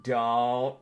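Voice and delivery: playful yell, swelling with each repeat into laughter; mock yelling. Voicing the other player's brain yelling a warning, mock yelling. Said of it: "your brain's just yelling".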